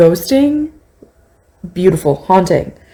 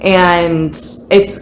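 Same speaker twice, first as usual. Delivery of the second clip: ordinary talk, nothing marked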